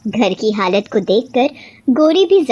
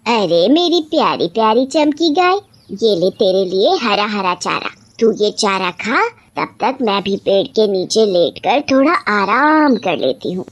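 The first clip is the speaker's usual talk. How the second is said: doting witch. Acted. Witch feeds her beloved cow before resting, fond. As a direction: coax the cow fondly in a witch's voice.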